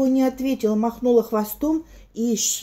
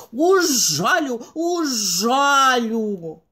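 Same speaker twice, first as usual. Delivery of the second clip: slow buzzing threat; buzzing bee voice; much slower than usual. Acted. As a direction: buzz it like an angry bee. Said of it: "пчела ... жужжит".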